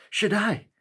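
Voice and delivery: high excited shout of a name; a boy yells for the maker as he bursts in; calling out. Acted. Boy bursts into workshop calling the maker, high excited shout.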